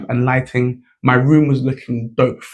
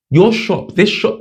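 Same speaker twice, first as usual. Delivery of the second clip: pitched up, emphatic; blunt verdict; 7 semitones higher, slower than usual. Retold or imitated. Young designer telling the petrol station clerk, emphatic and pitched up. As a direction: tell him straight his shop is bad. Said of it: "Those were my exact words to the guy".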